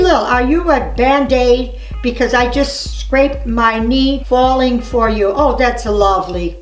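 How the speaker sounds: normal talking voice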